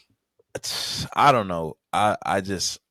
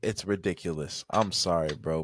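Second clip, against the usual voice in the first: ordinary delivery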